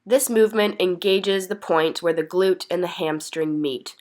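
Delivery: ordinary talk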